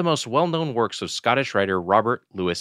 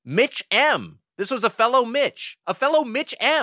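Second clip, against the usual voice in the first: rising higher and higher; high playful voice; 12 semitones higher than usual. Acted. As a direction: pitch it up gleefully. Playful emphasis on shared name.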